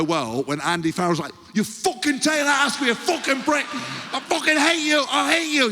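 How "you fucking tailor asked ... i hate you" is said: very high, hammed-up rage; mock furious yelling. Coach raging while lobbing bricks, mock furious yell. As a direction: rant wildly at a hated target.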